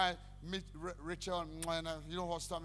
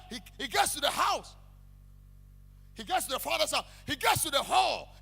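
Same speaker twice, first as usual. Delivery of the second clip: high, loud shouted preaching; fiery pulpit shout; preaching at full force; 8 semitones higher, 9 dB louder than usual. Real, not acted. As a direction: hammer each line at the congregation.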